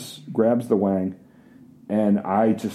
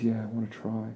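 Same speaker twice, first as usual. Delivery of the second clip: soft agreement; 11 dB quieter, faster than usual. Retold or imitated. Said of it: "She goes".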